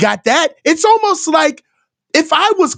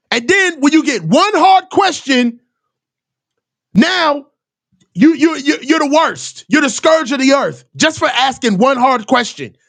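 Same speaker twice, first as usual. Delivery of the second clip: usual speaking voice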